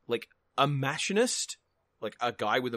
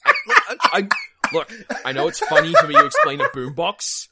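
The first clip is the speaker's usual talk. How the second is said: laughing; 11 semitones higher, 13 dB louder than usual. Real, not acted. Laughing at own explanation.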